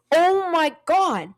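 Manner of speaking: loud, high, drawn-out "oh my God"; retold shock. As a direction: stretch it out in mock horror. Shocked at cousin's joke.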